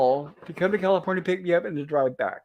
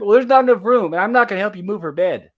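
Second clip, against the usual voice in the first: retold shouting; 2 semitones higher, 6 dB louder than usual. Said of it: "he kept raising his voice and shouting".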